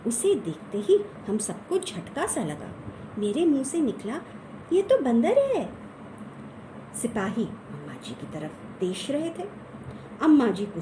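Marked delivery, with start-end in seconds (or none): 4.7-5.7 high, loud astonished cry; sudden high exclamation of disbelief; surprised. Acted. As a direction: blurt it out in shock. Shocked discovery it's a monkey.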